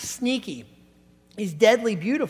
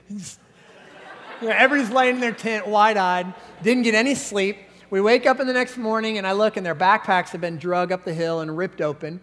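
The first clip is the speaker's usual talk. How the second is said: usual speaking voice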